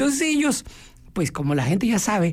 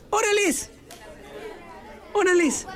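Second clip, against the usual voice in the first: "Órales" jumping much higher, a quick eager agreement; eager yes; 11 semitones higher, 3 dB quieter than usual. Retold or imitated. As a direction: snap out a high, keen "Órales" to accept the invitation. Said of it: "o tal vez te dicen, "Oye, ¿quieres ir conmigo al cine?". ("Órales" agudo y entusiasta, aceptando)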